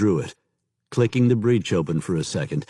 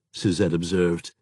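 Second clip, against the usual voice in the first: plain reading voice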